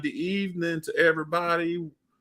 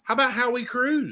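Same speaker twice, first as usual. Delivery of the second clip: higher, incredulous protest; surprised; 8 semitones higher than usual. Real, not acted. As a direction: sound caught off guard. Mock surprise at repeat.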